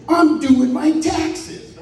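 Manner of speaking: high, drawn-out mock yell; retold indignant excuse. Late friend excusing himself on the phone, indignant mock yell. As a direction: holler it back indignantly, stretching the words.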